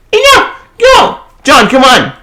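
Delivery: high sharp mock command; a lightly voiced urgent hero; commanding character. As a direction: order the hero out.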